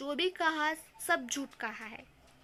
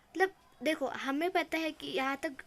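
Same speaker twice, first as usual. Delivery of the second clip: ordinary talk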